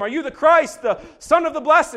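high, loud, demanding shout; high priest shouting. Retold or imitated. Caiaphas confronting the silent Jesus, loud shout. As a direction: hurl the question at him. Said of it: "freaks out and shouts at him".

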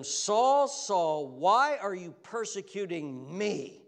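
loud, drawn-out questioning appeal; Jesus. Acted. Jesus confronting Saul on the ground, loud drawn-out appeal. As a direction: call out the name, then stretch the question. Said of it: "Jesus says to him".

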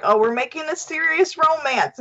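high, mock-serious filmmaker; mock grand voice. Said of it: "they were like".

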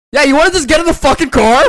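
a sharp, impatient yell; mock annoyed yell. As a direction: bark it like an impatient friend. Friend mocked mid-vlog, loud retort.

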